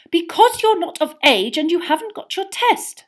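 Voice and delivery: loud, high motherly snap; snapping. A narrator's reading. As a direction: cut the boys off like a cross mother. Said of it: "snapped Missus Weasley".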